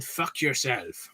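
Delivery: put-on French accent, slow and soft. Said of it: "as you can tell from the accent".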